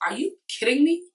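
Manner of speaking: high, loud disbelief; incredulous outburst. Real, not acted. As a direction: blurt it out in shocked outrage. Outraged reaction to Carly.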